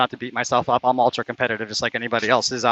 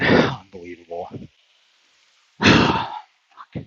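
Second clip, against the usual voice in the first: frustrated sigh; 5 semitones lower, much slower than usual. Real, not acted. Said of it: "I'm so mad right now".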